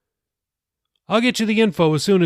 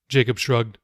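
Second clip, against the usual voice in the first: plain reading voice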